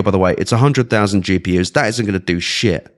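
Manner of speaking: ordinary talk, nothing marked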